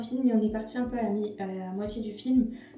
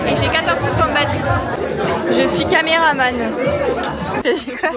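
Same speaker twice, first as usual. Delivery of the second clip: talking over loud music; 4 semitones higher, 12 dB louder than usual. Real, not acted.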